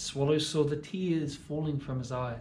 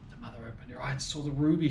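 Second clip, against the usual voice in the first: ordinary delivery, nothing marked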